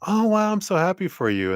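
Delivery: high-pitched gushing, thrilled for them; acted-out burst of motherly delight; delighted mom's heart. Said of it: "at first the heart's like".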